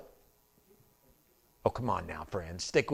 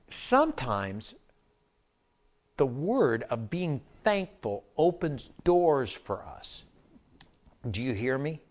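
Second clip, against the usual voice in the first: preaching; 2 semitones higher, slower than usual. Real, not acted. Preacher drives home sermon point.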